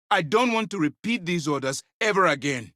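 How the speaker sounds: high, exasperated warning; stern reprimand. Acted. Commander tired of repeating orders, angry warning.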